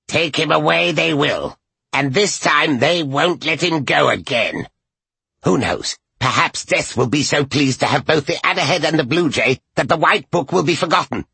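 high feverish cackling crone; feverish witch. A narrator's reading. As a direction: rush it, getting wilder. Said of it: "She was talking feverishly, faster and faster".

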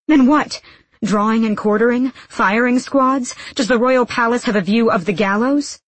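loud, high volley of questions; heated challenge. A narrator's reading. Woman listing executions at her accuser, heated challenge.

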